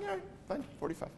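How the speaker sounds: soft, high, resigned giving in; quiet grudging God. Acted. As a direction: concede with a sigh. God voiced conceding.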